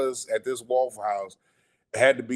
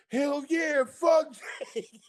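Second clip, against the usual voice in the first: much higher, yelled cheer; crowd shout; 14 semitones higher, much slower than usual. Retold or imitated. The Waffle House staff yelling back at him, rowdy shout. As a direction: holler it back like the whole diner. Whole diner answers him.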